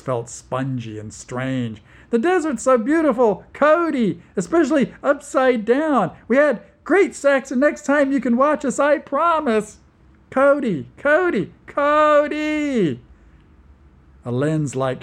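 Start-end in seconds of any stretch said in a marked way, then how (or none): 2.1-13.0 high, loud, fast manic chatter; woman babbling manically up at Cody; hysterical giddiness. Acted. Rabatine giggling hysterically at Cody.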